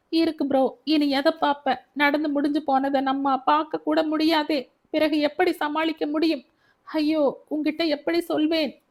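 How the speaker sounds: words spilling out through sobs, pitched high; breaking down in tears. Acted. Younger brother breaking down before his elder brother, tearful and high. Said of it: "வெடித்தழுதான்".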